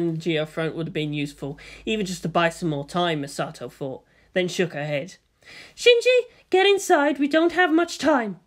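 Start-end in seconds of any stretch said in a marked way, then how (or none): 5.7-8.4 losing patience. Acted. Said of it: "glared, losing patience".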